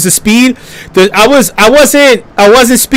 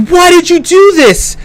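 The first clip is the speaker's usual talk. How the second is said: accusing bystanders; 8 semitones higher than usual. Retold or imitated. Bystanders accusing him, retold.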